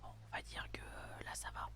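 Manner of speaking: hushed whisper, staying unseen; whispering while hiding. Real, not acted. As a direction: breathe the words, don't make a sound. Telling others to be silent.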